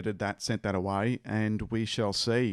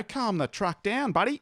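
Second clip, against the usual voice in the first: mock scolding; 9 semitones higher, 4 dB louder than usual. Quoted ad line.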